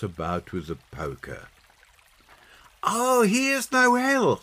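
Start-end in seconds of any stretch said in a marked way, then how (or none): 2.8-4.4 put-on high, drawn-out child's exclamation; little girl delighted by her find; excited girl. A narrator's reading. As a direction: call out slowly, high and loud, thrilled. Peggy finds a favourite carol.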